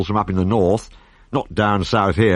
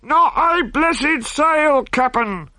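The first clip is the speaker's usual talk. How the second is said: very high, slow call from the masthead; high, drawn-out sailor's call down; lookout calling down; much slower than usual. A narrator's reading. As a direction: sing out from aloft. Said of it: "the man called down".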